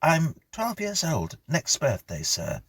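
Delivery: put-on a careful child answering his elder; polite young boy. Boy Stephen voiced.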